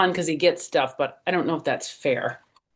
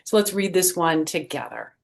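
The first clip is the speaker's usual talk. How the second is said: plain reading voice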